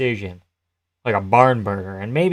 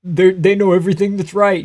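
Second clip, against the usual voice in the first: mock naive fan; 7 semitones higher, 4 dB louder than usual. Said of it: "and being like".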